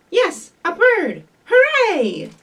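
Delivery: loud, high, drawn-out storybook cheer; excited cheer. Acted. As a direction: cheer it out big for little kids. Picture-book line, cheering with the children.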